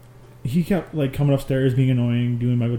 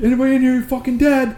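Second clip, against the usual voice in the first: higher, louder, laughing yell; mock tough shout; 11 semitones higher, 7 dB louder than usual. Clearing the house with a shotgun, laughing mock shout. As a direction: storm the room like a game hero. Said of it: "I was like".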